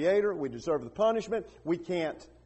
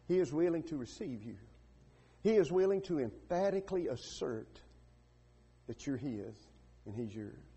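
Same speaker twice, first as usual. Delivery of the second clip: hushed, lowered preaching voice; earnest altar appeal; 6 dB quieter than usual. Real, not acted. Preacher to listeners uncertain of salvation, soft earnest appeal. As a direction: soften right down and plead sincerely.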